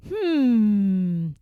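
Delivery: drawn-out pondering hum; long musing hmm; thoughtful hmm. Acted. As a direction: hum while figuring it out. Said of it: "he said to himself".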